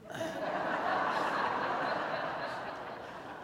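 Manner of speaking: high breathless giggle at his own confession; giggly laugh. Real, not acted. Pastor laughing at his new smoker news, high giggle. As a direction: laugh it off, almost whispered.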